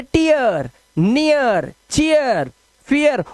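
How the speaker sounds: drawn-out "ee-yer" endings, as in नियर, चीयर; each word gliding from ई into "yer"; gliding vowel drill. Shown as a demonstration. Teacher drilling eer words, gliding vowel drill. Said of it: "इसके उच्चारण को इया".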